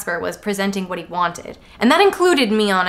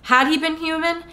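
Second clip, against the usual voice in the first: ordinary delivery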